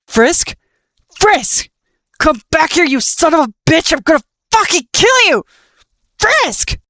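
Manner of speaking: very high, loud, frantic screaming; enraged wailing screams. Acted. Nightmare screaming after the vanished Frisk, enraged. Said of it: "the blighted skeleton wailed".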